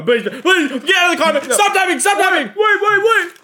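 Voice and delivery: high, loud panicked pleading; frantic shouting. Pretend. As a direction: yell it in a panic, begging.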